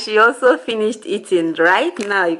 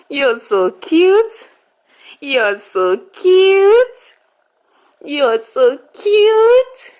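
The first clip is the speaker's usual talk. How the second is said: high, very slow, louder cooing; 9 semitones higher, 4 dB louder, much slower than usual. Real, not acted. Mother cooing over and over at her baby, high slow baby talk. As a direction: stretch each cute out high and slow.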